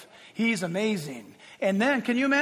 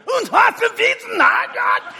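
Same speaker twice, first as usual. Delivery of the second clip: high, loud mock-German ranting; Hitler; 13 semitones higher, 9 dB louder, slower than usual. Preacher showing the crowd Hitler's famous clip, screaming in mock German. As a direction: scream it like a raving dictator. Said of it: "screaming like a stark raving idiot".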